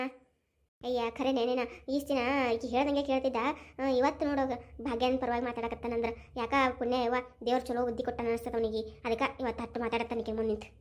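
put-on low gossiping old woman voice; old village woman. Old neighbour gossiping to the audience, low and sly. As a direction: cluck like a nosy old auntie next door.

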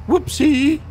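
a playful high-pitched imitation of a radio voice; mock squeaky whoopsie. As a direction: toss it off in a silly high little voice. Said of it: "floated in and went".

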